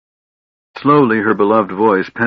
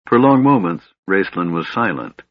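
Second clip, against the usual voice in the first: ordinary delivery, nothing marked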